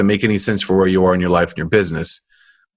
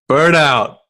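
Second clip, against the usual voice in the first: Burnout said louder, higher, falling; 6 semitones higher, 3 dB louder than usual. Shown as a demonstration. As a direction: punch out Burnout loudly. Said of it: "Say it one more time".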